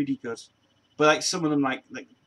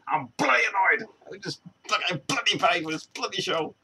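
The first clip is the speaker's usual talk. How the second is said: mock annoyed; 4 semitones higher than usual. Voicing the annoyed performer's thoughts about his show, mock angry. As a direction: grumble it up into an exasperated rant.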